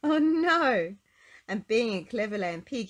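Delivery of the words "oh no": high, loud storybook cry of dismay; alarmed cry. A narrator's reading. Narrator as pig is about to land, crying out in alarm.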